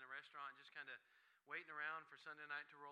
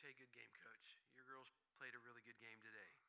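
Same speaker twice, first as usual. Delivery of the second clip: gracious; 4 semitones lower, 6 dB quieter than usual. Retold or imitated. Gracious words to rival coach.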